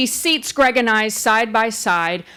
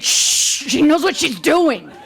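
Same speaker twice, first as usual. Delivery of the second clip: loud whisper; slower than usual. Retold or imitated. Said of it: "very loudly".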